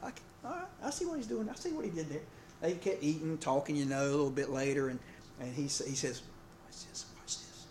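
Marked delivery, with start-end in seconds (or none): 0.0-2.2 higher, quieter voice catching on to the trick; eager newlywed. Retold or imitated. Pastor voices the newlywed watching his friend, high and softer. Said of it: "the newlywed guy was like". 6.7-7.7 whispered aside to a buddy; conspiratorial whisper. Retold or imitated. Experienced husband whispers to his newlywed friend.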